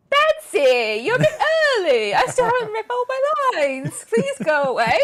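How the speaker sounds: loud, high mock-whiny Joker; Joker impression. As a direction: ham up a flustered Joker. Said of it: "My Joker impression".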